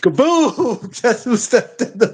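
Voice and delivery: high laughing announcer echo; playful entrance through laughter; laughing mock entrance. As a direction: shout the entrance while laughing. Echoing entrance line, laughing.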